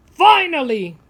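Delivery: loud, high exasperated sigh-word; grumpy old man. A narrator's reading. Old man finally reaching the cafe, loud and exasperated. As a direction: huff it out like a fed-up old man. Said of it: "he says".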